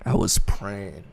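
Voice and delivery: soft, slow, fading to a whisper; whispered praying. Real, not acted. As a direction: let each word die into a whisper. Reliving tense hope.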